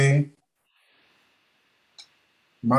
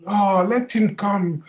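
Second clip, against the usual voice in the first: higher, slow and gentle; calm welcoming Buddha; 8 semitones higher, 3 dB louder, slower than usual. Acted. Buddha tells Ananda to let Mara in, slow and gentle. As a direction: invite him in without worry.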